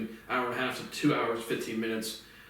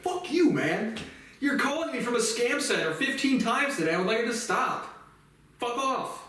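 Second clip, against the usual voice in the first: high-pitched mock rant; hammed-up angry rant, pitched way up; retold telling off; 10 semitones higher than usual. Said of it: "He's like".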